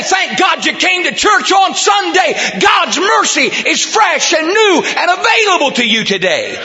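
high, ringing revival shout; full-force preaching. Acted. Preacher to congregation on fresh mercy, full-force and high. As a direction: cry it out to the whole church.

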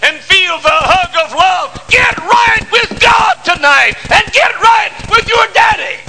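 preacher pleading at the top of his voice; full-force preaching. Acted. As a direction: build to a shouted climax. Preaching climax.

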